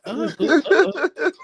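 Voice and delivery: high, giggly stammering uh-uhs; flustered laughing stammer. Retold or imitated. Caller's wife caught off guard on the line, laughing stammer. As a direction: stammer through nervous giggles. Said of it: "she like".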